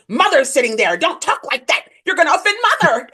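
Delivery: high, exasperated imitation of scolding in-laws; mock family scolding. Ex's family telling her off for talking that way, mock scolding. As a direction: imitate a scandalized relative, pitch climbing.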